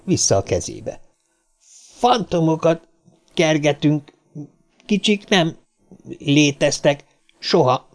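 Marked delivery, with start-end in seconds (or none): 2.0-7.9 halting, higher-pitched grumble; professor's broken-up grumble; grumbling. A narrator's reading. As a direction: grumble it out in separate chunks. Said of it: "dörmögte egy fűcsobóma kapaszkodva Vaughan professzor".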